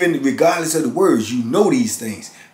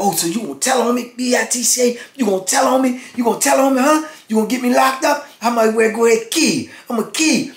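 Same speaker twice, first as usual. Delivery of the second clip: mock menacing threat; 9 semitones higher, faster than usual. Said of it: "Ron is telling him".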